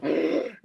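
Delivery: acted crowd gasp; sharp mock-shocked intake of breath; imitated gasp. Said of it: "Everybody's like".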